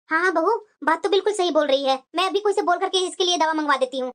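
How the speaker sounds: put-on an old mother-in-law nodding along; elderly mother-in-law.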